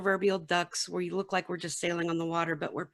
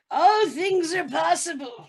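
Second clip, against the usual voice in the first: slow, high and loud, like a prophet; mock-solemn decree, high, loud and slow; grand proclamation; 12 semitones higher, 7 dB louder, slower than usual. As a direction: proclaim it like an oracle. Grand joke reply to Ben.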